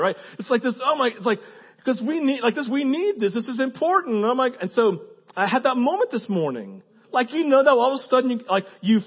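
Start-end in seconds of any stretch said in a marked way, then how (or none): none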